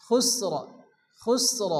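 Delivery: খুসর stopped on joined s and r; vowelless stop ending. Shown as a demonstration. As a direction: drop the in ending, close খুসর on r. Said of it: "দুইটি সাকিন যুক্ত অক্ষর একসঙ্গে".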